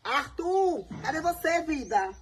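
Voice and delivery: high sing-song call across the house; calling out sweetly. Acted. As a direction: call him like a doting girlfriend looking for him. Calling out to Arthur in skit.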